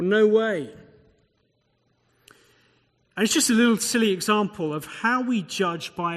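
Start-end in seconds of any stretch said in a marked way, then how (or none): 0.0-0.7 higher, louder mock objection; mock fan disbelief. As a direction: lightly play a supporter disputing the call. Acted fan disputing a penalty.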